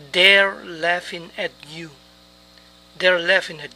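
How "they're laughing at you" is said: slow, careful enunciation for learners; slow model phrase. Shown as a demonstration. Teacher modelling an English phrase to learners, slowly. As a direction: say each word slowly and distinctly.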